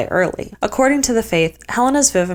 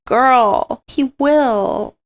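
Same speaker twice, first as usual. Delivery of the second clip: sassy aside; 6 semitones higher, 4 dB louder, much slower than usual. Real, not acted. Aside to character, sassy comment.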